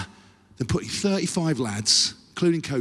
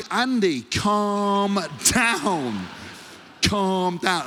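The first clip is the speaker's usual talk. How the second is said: high, stretched-out appeal; mock pleading; 6 semitones higher, much slower than usual. Player begging his raging coach, high pleading. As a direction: plead with a madman, drawing it out.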